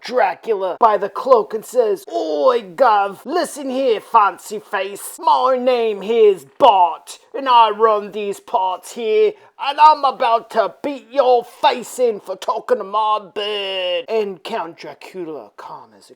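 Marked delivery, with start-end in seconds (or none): 2.1-14.0 slow, loud British threat; mock British tough. Bart threatening Dracula over his girl, mock British bruiser. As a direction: drag out the threat in broad British.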